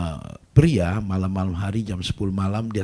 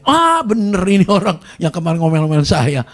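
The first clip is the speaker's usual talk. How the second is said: excited recognition; 9 semitones higher, 8 dB louder than usual. Retold or imitated. Husband recognizes pastor.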